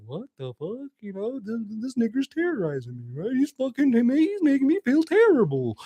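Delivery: the dog's thoughts. Retold or imitated. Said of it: "he's looking at me like".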